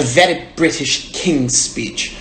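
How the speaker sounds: lightly put-on British royal accent; a hint of a British King's speech accent. Shown as a demonstration. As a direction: say it in the King's English, British and proper. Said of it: "when I do my British accent".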